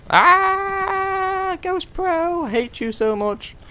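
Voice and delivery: high furious cry; frustrated yell. Real, not acted. Killed by enemy, frustrated.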